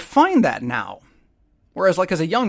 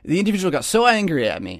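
ordinary delivery

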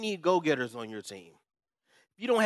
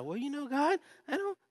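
hesitant elder; 10 semitones higher, 3 dB quieter than usual. Retold or imitated. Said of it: "one elder sometimes is just kinda".

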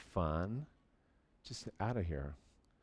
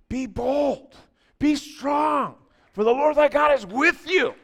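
loud, high shout of "Be bold, be strong"; booming scripture proclamation; 13 semitones higher, 11 dB louder than usual. Acted. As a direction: proclaim it at full force. Preacher quoting scripture boldly.

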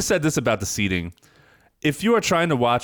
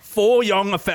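mock British accent; 9 semitones higher, 3 dB louder than usual. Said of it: "in a British accent".

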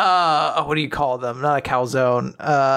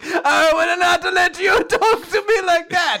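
high shouted Italian indignation; hammed-up Italian outrage; mock Italian accent; 12 semitones higher, 3 dB louder than usual. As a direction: rebuke them in cartoon Italian. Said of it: "See if you still have the accent".

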